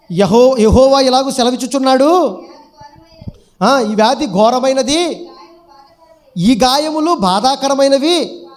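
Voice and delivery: loud, slow proclamation; forceful scripture reading. Acted. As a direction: declaim God's judgement to the whole congregation. Reads God's words aloud.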